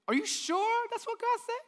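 high, doubting sneer of the tempter; high mocking serpent voice. Serpent questioning God's word to Eve, high mocking voice. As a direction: sow doubt in a thin high voice.

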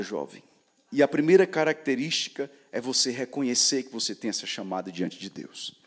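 usual speaking voice

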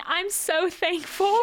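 high, drawn-out tearful gush; slow, hammed-up crying; mock sobbing. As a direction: imitate a kid overcome with grateful tears. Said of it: "this kid is sobbing and among it saying".

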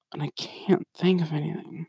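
breathy whispered aside. Real, not acted. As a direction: drop to a whisper, stuck for words. Frustrated aside to viewers.

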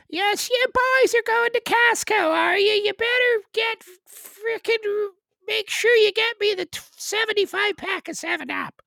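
very high, loud rural Newfoundland voice; Newfoundland accent. Acted. Newfoundlander sends the boys to Costco, loud high Newfoundland accent. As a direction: order the boys about in a high Newfoundland caricature. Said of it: "it's an Irish sort of accent".

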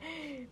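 sharp startled intake of breath; mock gasp of shock. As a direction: gasp in sudden realization. Reacts to learning she offended friend.

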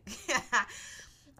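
short high laugh cracking up; burst of laughter. Real, not acted. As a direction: break into a laugh at the joke. Laughing at her own joke.

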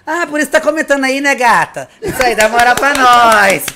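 hamming it up for the wife in the chat; loud playful call-out. Real, not acted. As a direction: shout it out with a laugh. Loudly teasing wife in chat.

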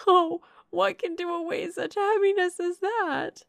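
high, fervent, grief-laden voice; impassioned distress. A narrator's reading. Remorseful sister insisting Edward loves Eleanor, impassioned distress. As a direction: plead fervently, rising to a long high wail.

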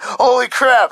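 high, loud exclamation of shock; retold astonishment. Waking up to a swollen hand, astonished. Retells own shock that morning.

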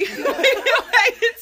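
high, slow laughing tease; giggling through the words. Real, not acted. Teasing mom, laughing.

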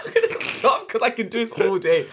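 laughing. Real, not acted. To listeners, laughing that she can't stop. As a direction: giggle while giving up.

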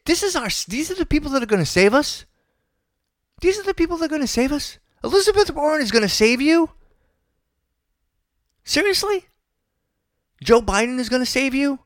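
you cannot be serious; incredulous disbelief. Real, not acted. Exasperated rant about candidates.

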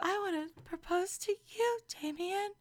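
high whisper-voice impression; Giannina. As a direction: put on a high, hushed girly proposal voice. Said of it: "you mean whisper voice?".

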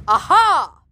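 high, loud exclamation; loud triumphant cry; shouted. A narrator's reading. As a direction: shout it out in sudden discovery. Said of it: "shouted a voice".

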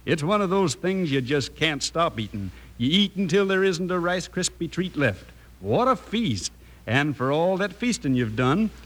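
normal talking voice

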